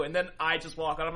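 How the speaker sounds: usual speaking voice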